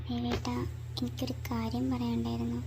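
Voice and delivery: soft, low and private; hushed, hesitant. Acted. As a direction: broach it quietly with your husband. Hesitant private confession to husband. (പതിഞ്ഞ സ്വരത്തിൽ, മടിച്ച്)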